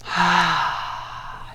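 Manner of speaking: soft unvoiced haa out the open mouth; breathy sigh. Shown as a demonstration. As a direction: exhale a quiet whispered ah. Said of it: "open the mouth for this breath".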